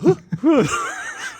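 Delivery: high, sharp laughing gasp; shocked gasp. Retold or imitated. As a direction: gasp in surprise. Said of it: "I was a little shocked".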